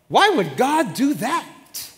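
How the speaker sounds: slow, drawn-out indignant question; upset complaint. Retold or imitated. Upset people complaining against God, slow and drawn out. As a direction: whine it out as if wronged.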